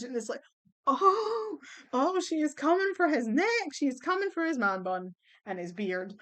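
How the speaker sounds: ordinary delivery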